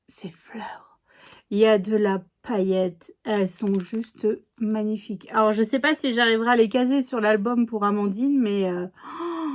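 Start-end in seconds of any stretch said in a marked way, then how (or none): 0.1-1.0 hushed, voiceless whisper; awed whisper. Real, not acted. Vlogger showing viewers favourite flowers, awed whisper. 8.9-9.5 soft, drawn-out swooning gasp; admiring gasp. Real, not acted. Vlogger swooning over sparkly flowers, soft gasp.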